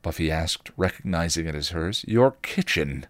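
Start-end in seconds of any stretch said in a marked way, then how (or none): none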